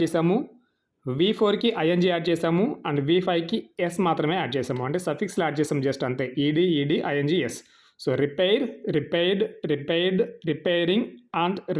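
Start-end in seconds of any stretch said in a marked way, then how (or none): none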